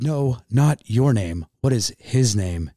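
slow, with "your" and "his" punched; emphatic correction. Retold or imitated. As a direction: spell out the contrast between "your" and "his". Nurse correcting his mistaken answer.

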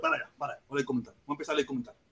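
muy bajito, casi para sí; murmurando para sí. Real, not acted. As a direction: masculla apartado del micro. Habla para sí, apartado. (murmullo para sí mismo)

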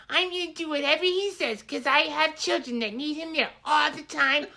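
high mocking falsetto-like whine of Robin. Mocking Robin's words to cohost in a high whiny voice. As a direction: parody a whiny wife, pitched high. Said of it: "He was mocking her".

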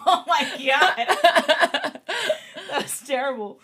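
real laughter at a botched name; laughing. Real, not acted. Laughing at her own misreading.

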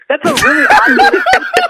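high, loud, laughing through the line; can barely get it out for laughing; laughing in disbelief at the odd ending. Real, not acted.

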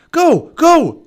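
loud, high urgent command; urgent shout. A narrator's reading. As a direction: shout to get someone running. Urgent flight from a dinosaur.

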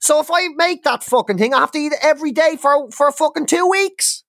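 high, heated venting; fed-up complaint; exasperated rant. Real, not acted. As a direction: rant about cooking for one. Ranting about cooking alone.

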